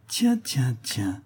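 intrigued surprise. Acted. Clerks react to scandalous gossip.

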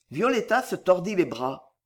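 usual speaking voice

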